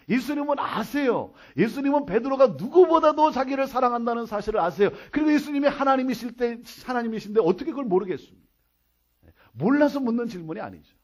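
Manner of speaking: insistent pulpit proclamation; emphatic preaching. Real, not acted. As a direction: declare it to the congregation with conviction. Preaches at full force.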